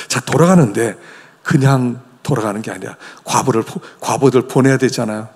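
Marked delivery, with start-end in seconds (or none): none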